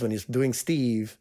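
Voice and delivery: usual speaking voice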